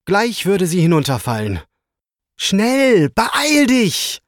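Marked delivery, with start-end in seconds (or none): 2.4-4.2 very high, urgent cheer-on; urging on. A narrator's reading. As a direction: spur the dragon on, pitched right up. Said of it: "feuerte Ritter Timo den grünen Flugdrachen an".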